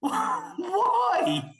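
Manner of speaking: high, half-laughing cry of disbelief; laughing, incredulous shout; exasperated outcry. Real, not acted. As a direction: throw your hands up, pitch jumping high.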